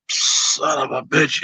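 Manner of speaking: low, soft, slow frustrated curse under the breath; muttered curse. Real, not acted. Fan venting about Rodgers, low and soft. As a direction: let it slip out quietly.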